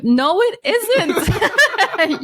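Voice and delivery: high, very slow, laughing retort; incredulous. Real, not acted. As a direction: drag it out in disbelief. Retort to quoted claim.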